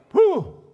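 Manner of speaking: high loud whew of excitement; delighted exhale; excited whew. Real, not acted. Exclaims after calling out.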